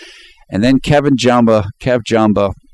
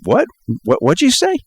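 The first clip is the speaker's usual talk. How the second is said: pitched way up in mock outrage; indignant what-did-you-say squeak; offended disbelief; 8 semitones higher than usual. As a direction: act like you can't believe what you just heard. Offended [name] reacts to jab.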